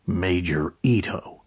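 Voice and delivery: softly mumbled name. Acted. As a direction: mumble it under your breath. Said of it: "Jack mumbled".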